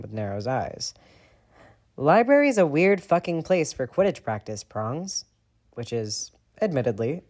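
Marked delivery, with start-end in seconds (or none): 2.0-5.2 loud, sarcastic accusation; angry. A narrator's reading. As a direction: call out the lie. Sirius confronting James.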